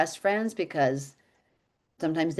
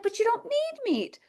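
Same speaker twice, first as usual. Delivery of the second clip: protesting. Retold or imitated. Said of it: "I'm like".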